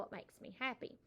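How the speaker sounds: ordinary talk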